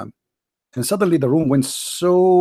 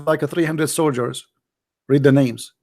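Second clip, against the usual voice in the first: ordinary delivery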